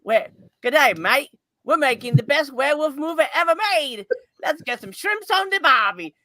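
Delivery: jokey Aussie-accent impression; Australian accent. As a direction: put on a broad mock Australian accent. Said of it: "Australian accent".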